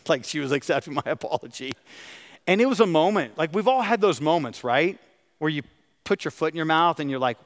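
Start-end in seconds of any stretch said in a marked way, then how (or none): none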